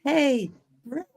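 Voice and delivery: put-on high-pitched kid calling out; little kid. Little kid greeting Brett, high imitated child voice. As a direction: pipe up like an eager little kid. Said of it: "like the little kid going".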